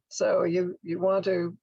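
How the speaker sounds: ordinary talk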